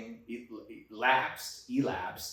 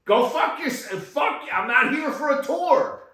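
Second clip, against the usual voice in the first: high, loud sarcastic list ending in a yell; mock furious tirade; 6 semitones higher, 4 dB louder than usual. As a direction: spit out each aisle, then explode. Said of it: "I was pissed".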